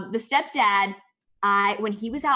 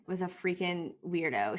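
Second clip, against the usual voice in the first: ordinary talk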